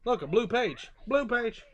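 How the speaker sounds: plain reading voice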